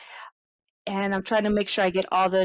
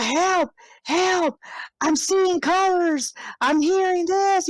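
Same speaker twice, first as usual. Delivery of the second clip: high, drawn-out cries for help; wailed mock panic, pitched way up; panicked caller; 7 semitones higher, slower than usual. Stoned man calling nine one one, mock panicked. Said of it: "a guy calling nine one one".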